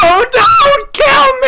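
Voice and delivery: high, desperate screaming plea; panicked scream. Retold or imitated. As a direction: shriek it like you're being hunted. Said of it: "was that an accurate recreation".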